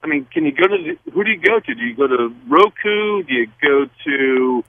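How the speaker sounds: normal talking voice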